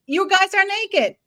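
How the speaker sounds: calling out. Acted. YouTubers calling out like the child.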